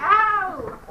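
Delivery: sharp ow of pain. Real, not acted. Student hit, cries out.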